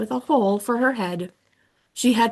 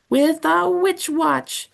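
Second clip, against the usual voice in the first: slow, high crone voice; old witch; 7 semitones higher, slower than usual. Acted. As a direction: drag it out like a cackling witch. Old witch answers riddle.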